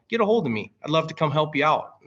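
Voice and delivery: ordinary delivery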